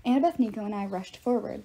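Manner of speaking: plain reading voice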